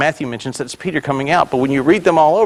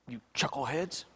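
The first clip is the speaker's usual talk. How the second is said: much softer aside after shouting; muttered jibe; 18 dB quieter than usual. Acted. Jesus to the disciples, as imagined by preacher, muttered jibe.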